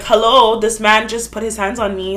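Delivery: loud re-enacted call for help; retold indignation. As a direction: call out to useless bystanders, exasperated. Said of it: "I was mad at all the men".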